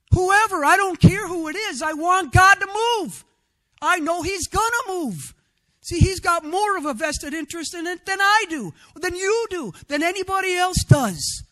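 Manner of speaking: loud preaching. Real, not acted. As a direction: call it out with full conviction. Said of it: "I'm sorry I'm loud".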